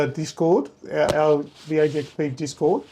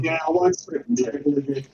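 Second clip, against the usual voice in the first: garbled through noise suppression; words smeared by noise cancellation; noise reduction artifacts; 4 semitones lower, 4 dB quieter than usual. Real, not acted. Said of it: "own noise cancellation on for all of them".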